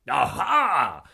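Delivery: loud triumphant shout. Acted. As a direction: shout aha with glee. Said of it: "shouted Sean".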